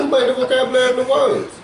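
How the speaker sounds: high, loud laughing challenge. Real, not acted. Co-host laughing while defending God's word choice, loud and high. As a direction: laugh as you make the point.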